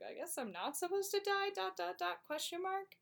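high, puzzled, trailing question; confused wondering. Retold or imitated. Posthumus waking up unsure he should live. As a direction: sound baffled and unsure.

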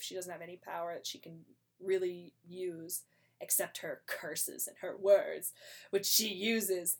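ordinary talk, nothing marked

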